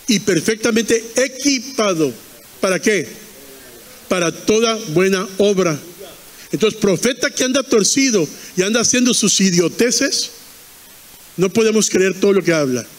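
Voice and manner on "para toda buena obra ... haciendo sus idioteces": ordinary talk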